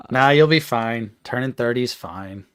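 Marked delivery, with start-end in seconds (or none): none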